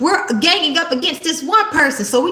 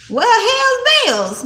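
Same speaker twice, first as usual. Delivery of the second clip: drawn-out mock exclaim; 8 semitones higher, much slower than usual. Real, not acted. Mock exclamation before having fun.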